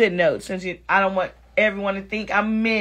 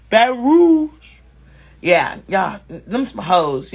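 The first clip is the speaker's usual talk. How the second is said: attempted Baton Rouge accent; slower than usual. Shown as a demonstration. Comic retrying after saying it wrong, drawn-out Baton Rouge accent. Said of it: "I still didn't say it right".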